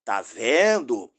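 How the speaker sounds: loud, drawn-out imitation of an opponent; exaggerated, slow mock exclamation; mock pointing-out. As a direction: mimic him loudly, stretching each word. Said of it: "aí você vai e diz".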